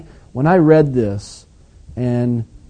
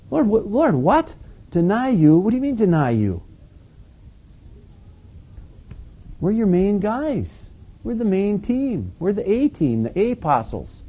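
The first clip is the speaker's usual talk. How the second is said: higher, disbelieving protest of the main guys; indignant disciples; 7 semitones higher than usual. Retold or imitated. Disciples objecting to Jesus that they would never deny him, high and indignant. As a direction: play the offended disciples, voice lifted in disbelief.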